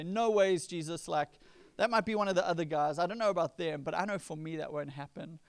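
loud, defiant brush-off. Retold or imitated. Peter insisting to Jesus, loud and defiant. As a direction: wave it away as if it could never be you. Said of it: "defiantly respond to him".

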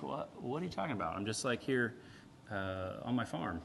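a regular guy who can't believe it; baffled Gideon. Retold or imitated. Gideon quietly confused at the angel's greeting. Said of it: "he's like".

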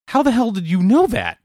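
high, loud astonished outburst; stunned. Retold or imitated. Shocked that a Southern clerk guessed their home state, high and loud. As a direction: blurt it out in total surprise. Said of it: "both looked at each other like".

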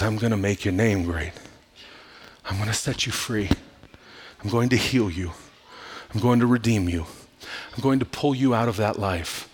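low, soft, spaced promises; soft, low reassurance; tender divine promise. Acted. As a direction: voice God's reassurance quietly, pausing after each promise. God's promises voiced before worship.

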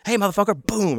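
high-pitched confrontational call, not shouted; retold angry outburst. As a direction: re-enact going off on someone. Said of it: "I fucking went off on him".

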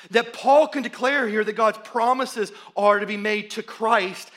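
slow, loud, emphatic preaching. Acted. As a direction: preach it with building force. Preaching climax on union in Christ.

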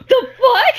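high startled shout through a laugh; shocked outburst. Retold or imitated. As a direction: yelp it out in disbelief.

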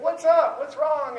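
put-on urgent check on a screaming child; startled parent calling back; alarmed dad. Dad pulls over, child screaming.

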